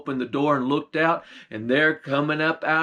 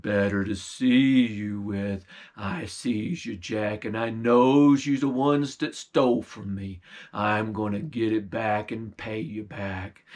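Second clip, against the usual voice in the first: low bear voice; 6 semitones lower than usual. Acted. Bear sniffing out the stolen meat, low and menacing. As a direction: rumble it like a hungry bear.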